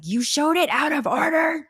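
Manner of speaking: mock outraged shout, high; hammed-up indignant cry; outraged online commenter. Mother quoting angry social media parents, mock shouting. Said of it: "people on social media that were just like".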